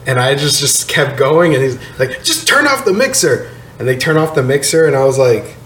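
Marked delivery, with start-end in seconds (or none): 2.2-3.5 higher stern bouncer order. Retold or imitated. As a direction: snap the order like security. Bouncer voiced in story.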